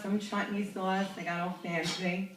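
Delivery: normal talking voice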